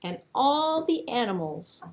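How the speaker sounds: usual speaking voice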